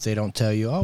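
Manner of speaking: usual speaking voice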